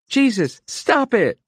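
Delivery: high, loud alarmed cry. A narrator's reading. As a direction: shout at them to stop. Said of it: "Iris cried".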